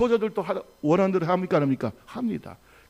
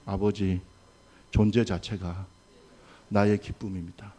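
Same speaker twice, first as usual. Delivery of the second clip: low, measured phrases for repeating; congregational lead-in; 10 semitones lower than usual. Shown as a demonstration. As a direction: recite solemnly in short phrases for others to echo. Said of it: "따라합시다". (따라 하도록 낮고 또박또박 선창)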